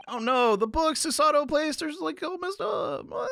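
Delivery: high whimpering wail; mock dismay. Mock dismay over messed-up books.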